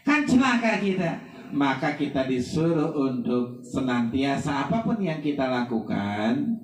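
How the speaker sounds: ordinary talk, nothing marked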